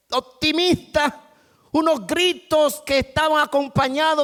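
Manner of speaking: high, loud storytelling from the pulpit; full-force preaching. Acted. Pastor telling the congregation the twins story, preaching loudly. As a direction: drive the story home to the church.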